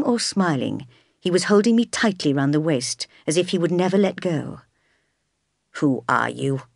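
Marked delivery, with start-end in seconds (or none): 5.8-6.6 low, menacing growl at a stranger; lower-pitched, threatening challenge; growled question. A narrator's reading. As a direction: growl it low, demanding an answer. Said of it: "he growled at the stranger".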